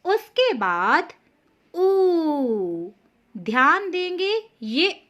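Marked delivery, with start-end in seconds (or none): 1.7-2.9 ऊ held long and clear; लंबा ऊ. Shown as a demonstration. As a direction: stretch the ऊ for the class. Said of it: "ये है ऊ".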